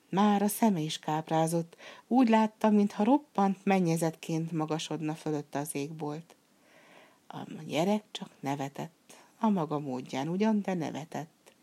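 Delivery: usual speaking voice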